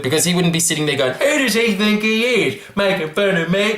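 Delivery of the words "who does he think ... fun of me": mock offended president. Offended president mocked by comedian.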